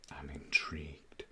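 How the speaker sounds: soft intrigued whisper. Real, not acted. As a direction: breathe it out quietly. Whispering about the kit.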